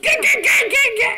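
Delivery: put-on high loud toddler gah gah; mimicking her son shouting in the restaurant; excited toddler. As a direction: squeal gah like an excited baby. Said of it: "then he goes".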